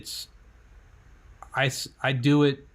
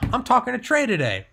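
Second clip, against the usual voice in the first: high-pitched excited self-talk; psyched up; 12 semitones higher than usual. Retold or imitated. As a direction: pump yourself up with the news. Psyching himself up.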